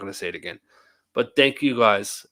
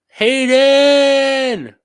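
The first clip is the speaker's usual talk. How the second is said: yelled name, much higher and louder; calling out loud; 14 semitones higher, 13 dB louder than usual. Real, not acted. Streamer calls out to Hayden across the room, full yell.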